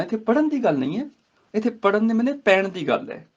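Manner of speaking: normal talking voice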